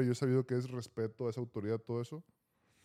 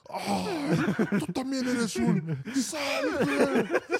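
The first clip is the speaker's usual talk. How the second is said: imitación muy aguda, fuerte y alargada; coach exasperado gritando; 12 semitones higher, 6 dB louder, much slower than usual. Retold or imitated. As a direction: explota contra otro jugador, actuando al coach. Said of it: "me empieza a gritar". (regaño a grito pelado, exagerado)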